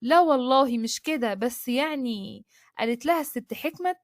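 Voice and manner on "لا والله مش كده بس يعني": plain reading voice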